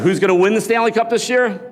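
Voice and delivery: loud, high rallying question; hype call. Real, not acted. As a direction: fire up the crowd. Hyping up the crowd.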